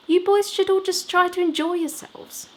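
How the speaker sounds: put-on a brisk woman talking down to the boys; manager's voice.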